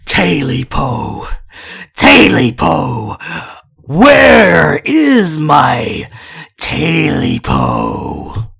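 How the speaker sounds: slow, drawn-out eerie creature call, rising to a shout; eerie creature voice calling from outside; hissing creature voice. Acted. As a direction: wail the name like a ghostly thing in the night. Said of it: "it hissed a little louder".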